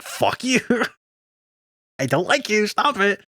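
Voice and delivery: high-pitched, laughing mock protest; mock outrage. Guy to the girl who teased him with a confession, flustered outrage. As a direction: squeal out a retold protest, breaking into laughter. Retold guy rejects her teasing joke.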